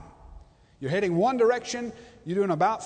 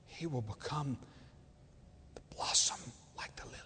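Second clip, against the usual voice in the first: tender voice of God; 6 semitones lower, 11 dB quieter than usual. Acted. Preacher voicing God's tender promise, softer and lower. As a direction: gently promise healing, almost to a hush. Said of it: "Notice the change in language".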